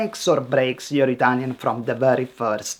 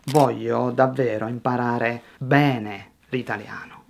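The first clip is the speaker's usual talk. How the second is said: lower, measured, with bene drawn out; slow down to highlight BENE; unhurried, giving the key words room; 4 semitones lower, 3 dB quieter than usual. Shown as a demonstration. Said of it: "slow down just at the key word".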